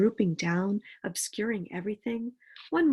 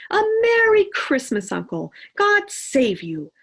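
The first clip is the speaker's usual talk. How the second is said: bright, loud, high festive greeting; cheerful cry; 7 semitones higher, 10 dB louder than usual. Acted. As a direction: burst in calling out good cheer. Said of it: "cried a cheerful voice".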